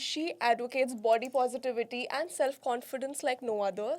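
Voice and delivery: podcast host intro. Acted. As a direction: welcome the viewers in a host read. Host's podcast intro read.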